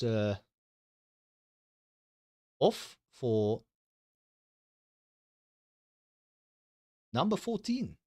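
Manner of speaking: lightly put-on German accent, halting; hesitant manager with a hint of German accent; Thomas Tuchel. Manager telling the sideline official about a substitution, mild German accent. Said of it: "Is anyone good at a German accent".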